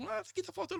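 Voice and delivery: high dismayed cry; shocked to find the gap; high-pitched alarm; dismayed discovery. Retold or imitated. Family finds missing pages.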